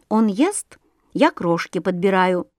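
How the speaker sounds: plain reading voice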